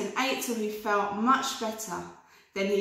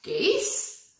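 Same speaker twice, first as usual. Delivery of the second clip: higher, questioning "Geese"; helpful sheep; 7 semitones higher than usual. Acted. Sheep suggesting a rhyme, bright and higher. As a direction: offer it brightly. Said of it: "suggested the sheep".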